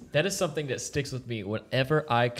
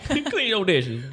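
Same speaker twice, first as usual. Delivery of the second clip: mock ad line; 10 semitones higher, 7 dB louder, much slower than usual. Mock dish soap ad.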